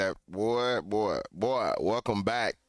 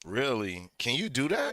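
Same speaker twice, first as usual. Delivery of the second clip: surprised; 5 semitones higher than usual. Real, not acted. Reacting in disbelief to story.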